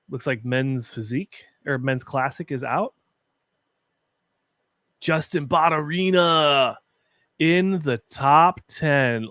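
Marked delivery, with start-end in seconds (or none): 5.0-9.3 slow, loud, drawn-out announcement of a friend's placing; excited callout. Real, not acted. Streamer announcing his friend made top ten, excited and drawn out. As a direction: call his name out slowly, thrilled. Friend's name called in results.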